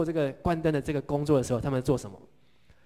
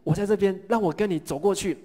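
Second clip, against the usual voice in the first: confident plea; 6 semitones higher, 5 dB louder than usual. Acted. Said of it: "彼得呢，他很有信心".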